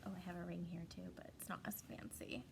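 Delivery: fast, fading soft aside; quick aside. Acted. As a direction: toss it off under your breath. Teacher aside about plain ring.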